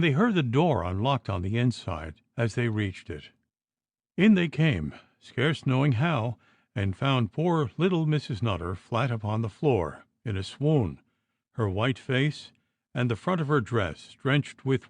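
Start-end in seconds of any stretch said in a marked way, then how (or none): none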